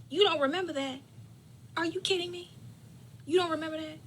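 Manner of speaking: high-pitched disbelief; can't believe her ears; high, astonished you don't remember that; incredulous. Real, not acted. Disbelief at mother.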